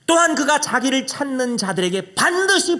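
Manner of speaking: slow, solemn recitation; scripture reading. Acted. Preacher reads Hebrews to the congregation, solemn. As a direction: read it out as holy words.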